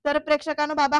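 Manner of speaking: plain reading voice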